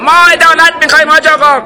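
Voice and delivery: fast, very high, loud yell; shouted demand. A narrator's reading. Crowd member demanding justice from the sheikh, shouting. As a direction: shout back in a rush.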